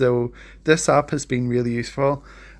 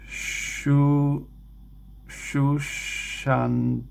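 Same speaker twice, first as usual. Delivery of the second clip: sounding out; 3 semitones higher, much slower than usual. Real, not acted. Learner sounding out Bengali script.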